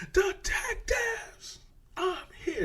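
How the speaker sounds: very high, loud scream for attention; sudden yell. Retold or imitated. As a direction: shriek it out to make everyone turn. Killer suddenly demands ignored detectives' attention.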